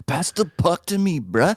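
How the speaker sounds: high surfer bro voice; surfer dude. Pretend. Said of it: "talks like a surfer dude".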